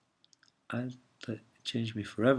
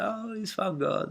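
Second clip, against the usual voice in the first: mock old ladies; 11 semitones higher, slower than usual. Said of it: "and everyone was".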